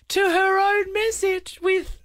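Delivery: high, slow words through giggles; real laughter breaking through; laughing. Real, not acted. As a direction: read it while cracking up. Laughing while reading reply.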